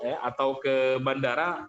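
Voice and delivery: usual speaking voice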